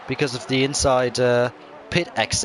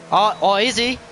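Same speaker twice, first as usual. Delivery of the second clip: high, loud, thrilled race call; excited motorsport commentator; 9 semitones higher, 3 dB louder than usual. Real, not acted. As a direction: call the crash as it happens. Crash call, motorsport commentator live.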